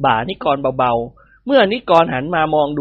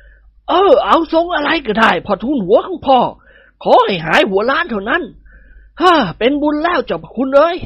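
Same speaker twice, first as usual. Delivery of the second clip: put-on overjoyed old nobleman; 8 semitones higher than usual. A narrator's reading. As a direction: beg happily, almost breathless with joy. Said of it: "ตื่นเต้นดีใจเป็นล้นพ้น". (เจ้าคุณดีใจล้นพ้นพูดเสียงสูง)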